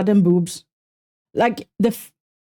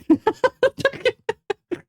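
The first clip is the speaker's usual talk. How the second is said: high-pitched staccato giggle; a run of high, quick laughs; 14 semitones higher than usual. Real, not acted. Streamer joking about where they're from, high giggle.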